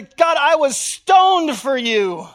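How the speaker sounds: aggrieved apostle complaining to God; Paul's slow protest. Acted. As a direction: spell out the suffering for God.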